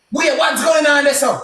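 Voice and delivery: high-pitched, indignant question; retold protest. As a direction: call it out as if taken aback. Retells his protest to the officers.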